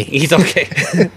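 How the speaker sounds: slow soothing reassurance; calm it down like a parent; slow reassuring. Retold or imitated. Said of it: "he should have said".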